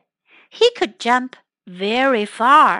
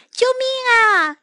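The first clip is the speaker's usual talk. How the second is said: cry for help; 11 semitones higher than usual. Acted. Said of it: "老虎叫了起来".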